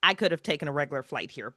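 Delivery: normal talking voice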